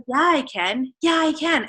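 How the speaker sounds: reliving a burst of resolve; pumped up. Retold or imitated. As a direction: retell suddenly believing in yourself. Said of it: "I was literally so pumped".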